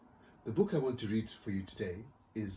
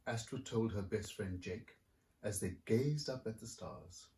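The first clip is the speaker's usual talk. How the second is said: lively picture-book reading; story narration; 2 semitones higher than usual. A narrator's reading. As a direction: read it like to a room of kids. Author reads picture book aloud.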